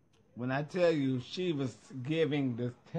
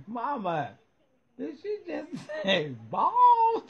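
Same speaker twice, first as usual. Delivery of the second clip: put-on high, louder, laughing kid asking mama; loud child's question in church; 10 semitones higher, 5 dB louder than usual. Kid in church asking mama, loud high giggling question. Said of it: "I would have lit that up".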